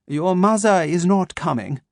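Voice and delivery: put-on slow, crisp German-accented menace; measured German doctor, each word placed; a calm German voice waking his captive. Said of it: "his German accent crisp and sharp".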